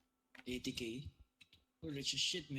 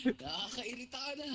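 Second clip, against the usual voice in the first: laughing; 7 semitones higher, 7 dB louder than usual. Real, not acted. Annoyed, laughing at friend.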